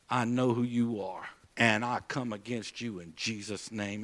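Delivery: low, softened, measured declaration; under the breath. Retold or imitated. Believer praying at the devil before entering the room, under the breath. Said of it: "So under my breath".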